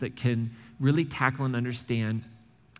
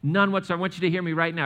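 normal talking voice

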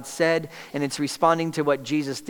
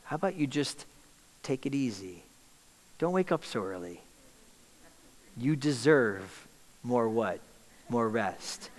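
soft coaxing voice; 3 semitones lower, 5 dB quieter than usual. Acted. Enemy's suggestion to skip prayer.

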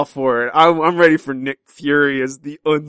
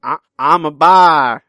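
slow, loud mock imitation of a friend; a drawn-out impression of the co-host; imitating Eric; 3 semitones higher, 8 dB louder, slower than usual. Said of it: "That's not how I sound".

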